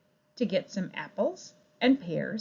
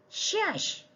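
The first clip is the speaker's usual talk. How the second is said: rat shushing; 11 semitones higher, 4 dB quieter than usual. Acted. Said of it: "said the rat".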